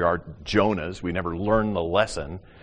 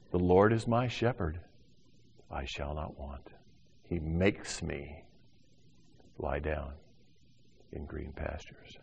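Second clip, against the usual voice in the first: soft, slow psalm reading, lower and hushed; soft psalm recitation; 5 semitones lower, 8 dB quieter than usual. Acted. Preacher reciting Psalm 23 to the congregation, hushed and slow. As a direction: read it gently like a prayer.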